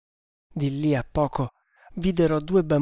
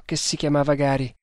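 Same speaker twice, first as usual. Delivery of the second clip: usual speaking voice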